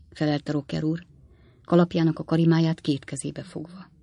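plain reading voice

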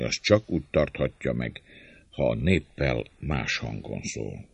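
ordinary delivery, nothing marked

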